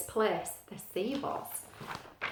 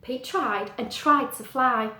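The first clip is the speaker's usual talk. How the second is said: plain reading voice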